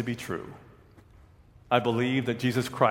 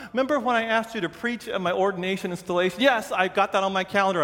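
fast, higher back-and-forth; quick retold chat; 6 semitones higher, faster than usual. Pastor and Rodney on the phone, fast and eager. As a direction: rattle it off eagerly.

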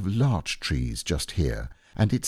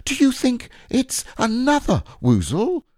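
high, louder anxious squeak; alarmed Piglet; 4 dB louder than usual. A narrator's reading. Piglet asking Pooh about another woozle, anxious and high. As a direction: ask it with wide-eyed fear. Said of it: "cried Piglet".

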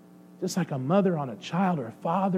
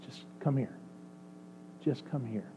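much softer, gentle calling; tender invitation; 4 semitones lower, 14 dB quieter than usual. Acted. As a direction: coax someone gently into your arms. God tenderly calling a hurting person.

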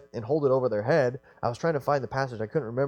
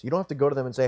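ordinary delivery

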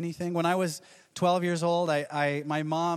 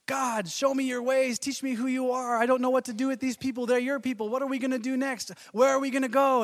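higher, anxious pleading to God; pleading Moses; 7 semitones higher, 3 dB louder than usual. Retold or imitated. Moses pleading with God about his people. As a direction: beg God for answers, fretful. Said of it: "Moses says".

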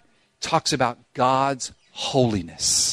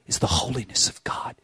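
softer, hushed whisper on holiness; solemn whisper; 4 semitones lower, 7 dB quieter than usual. Real, not acted. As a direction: drop to a reverent whisper.

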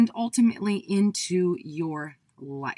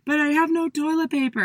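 upset insisting; 9 semitones higher than usual. Retold or imitated. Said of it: "I said".

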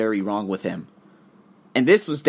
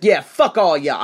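slow, louder spat-out contempt; angry dismissal; 5 semitones higher, 8 dB louder than usual. Retold or imitated. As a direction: throw it at the whole crowd. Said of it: "I was just so angry".

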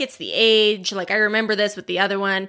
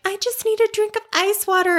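put-on much higher, small pleading kid voice; imitating a child; 10 semitones higher than usual. Child sneaking out of bed asking for water, high whiny voice. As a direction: play the kid stalling bedtime.

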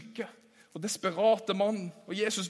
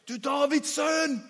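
higher, slow pleading cry of a blind beggar; blind man's cry; 7 semitones higher, slower than usual. Acted. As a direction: call out to Jesus, drawn out and begging. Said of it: "roper han". (tigger som roper ut)

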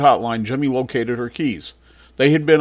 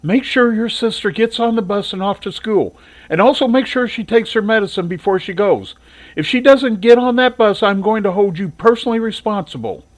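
a hint of a stern mother, higher; mother warns her son as she leaves, lightly stern; 8 semitones higher, 3 dB louder than usual. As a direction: lay down the law on her way out. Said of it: "she said".

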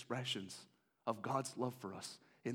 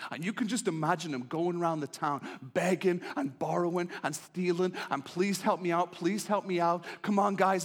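pleading build-up; 7 semitones higher, 9 dB louder than usual. Acted. Hosea begging around town.

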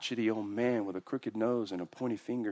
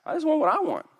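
whiny hard heart; 6 dB louder, faster than usual. Retold or imitated.

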